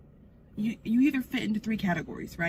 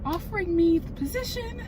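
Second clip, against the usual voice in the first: higher, thrilled reveal of the news; excited; 10 semitones higher, 5 dB louder than usual. Real, not acted. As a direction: let the joy break through. Reveals job offer.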